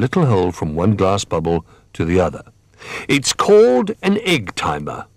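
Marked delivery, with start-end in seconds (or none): 3.1-5.0 high-pitched proud announcement for a story character; announcing. A narrator's reading. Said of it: "announced Bramwell".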